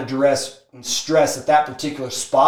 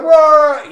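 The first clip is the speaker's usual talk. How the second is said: high, loud wordless wail; hammed-up blubbering; mock bawling; 11 semitones higher, 7 dB louder than usual. Smoker asked if he wants to quit, mock bawling. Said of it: "he's just crying".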